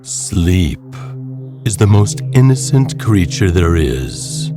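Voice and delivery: normal talking voice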